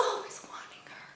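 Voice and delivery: breathy unvoiced whisper, fast. Real, not acted. Reviewer swooning to viewers over his devotion, whispering. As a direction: whisper it like a secret.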